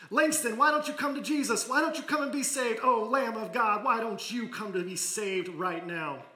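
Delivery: high, loud preacher's plea; fervent plea. Acted. Minister's last loud plea to Langston to be saved. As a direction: cry out to the boy, begging.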